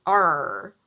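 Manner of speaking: loud, drawn-out pirate arr. Shown as a demonstration. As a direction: let out a big pirate "arr". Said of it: "pirates say".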